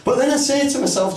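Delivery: ordinary talk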